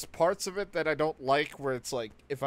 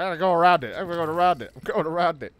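loud, eager repeated voice; like an overexcited dog; excited dog voice; 3 semitones higher, 6 dB louder, faster than usual. Retold or imitated. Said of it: "He's like".

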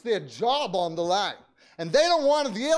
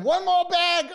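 high, insistent ask for one more; determined request; 8 semitones higher, 4 dB louder than usual. Retold or imitated. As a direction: say it up high like a vow.

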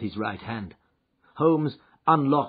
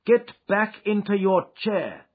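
sternly; 12 semitones higher, 9 dB louder than usual. Acted. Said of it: "said Holmes sternly".

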